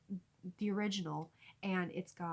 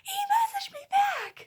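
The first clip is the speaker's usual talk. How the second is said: very high, loud squeal; breathless excited shriek; 12 dB louder than usual. Retold or imitated. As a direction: re-enact shrieking the news out with no air. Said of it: "finally get enough air to say".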